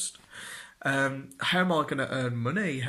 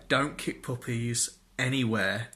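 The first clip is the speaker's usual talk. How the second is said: normal talking voice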